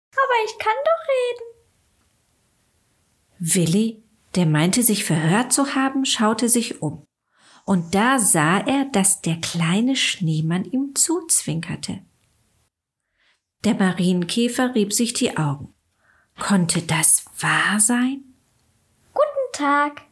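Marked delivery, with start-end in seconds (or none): 0.1-3.6 much higher snow woman voice; a cheerful snowwoman, pitched way up; chattering melting snowman voice. A narrator's reading. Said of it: "räusperte sich jemand hinter ihm und sagte". 19.1-20.0 high snow woman voice, a friendly greeting; chattering melting snowman voice. A narrator's reading. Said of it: "sagte der Schneemann".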